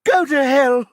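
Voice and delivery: piercing, very high, loud shriek. A narrator's reading. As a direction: shriek it at the prosecutor. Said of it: "she shrieks at Singine".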